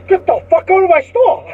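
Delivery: high, loud furious outburst; explosive imitated eviction yell; acted angry shout. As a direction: yell it as an enraged shopkeeper. Teacher throws him out, retold.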